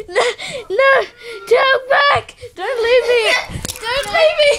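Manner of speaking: play-acted abandonment cry; mock desperate plea. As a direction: beg a friend to stay, hammed-up. Mock plea as friend exits.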